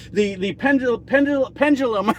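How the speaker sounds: stumbling over the word pendulum; stuttering. Real, not acted. Vlogger tripping over a word he can't pronounce, stuttering. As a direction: trip on the word, restarting it. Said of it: "I don't know why I'm stuttering".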